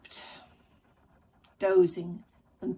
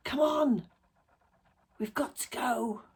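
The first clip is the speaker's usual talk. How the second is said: urgent, hissed prodding; 4 semitones higher than usual. A narrator's reading. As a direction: hiss it to hurry a companion along. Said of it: "hissed Magda".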